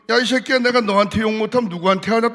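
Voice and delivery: the conference secretary cursing the young preacher, mock loud scold; gruff retort. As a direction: snap back like a rude superior. General secretary cursing him, retold.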